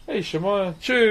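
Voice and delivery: ordinary delivery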